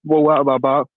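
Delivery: normal talking voice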